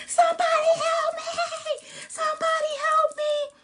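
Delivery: high-pitched, loud cry for help; acted shriek of someone in terror; panicked screaming. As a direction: scream desperately for rescue. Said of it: "they were still screaming".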